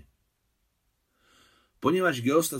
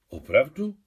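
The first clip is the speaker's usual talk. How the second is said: embarrassed surprise; 3 semitones higher, 6 dB louder than usual. A narrator's reading. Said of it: "Zrozpačitila".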